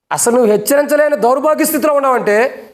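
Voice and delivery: preaching at full force. Acted. Pastor rebuking parents.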